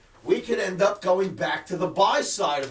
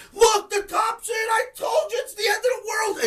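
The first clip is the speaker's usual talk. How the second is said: mock panicked doomsayer; 11 semitones higher than usual. Host imitates a chat-room member rushing in, mock wailing alarm. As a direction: play a hysterical trader wailing it's over. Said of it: "rushes in the room".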